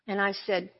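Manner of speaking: ordinary talk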